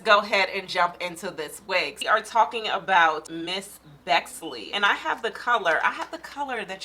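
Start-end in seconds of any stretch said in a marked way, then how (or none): none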